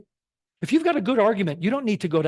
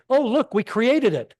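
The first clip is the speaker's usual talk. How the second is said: higher, mock-delighted claim; put-on wonder, pitched up; mock triumph; 6 semitones higher, 3 dB louder than usual. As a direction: imitate scientists feigning a discovery. Mimics scientists claiming they made it.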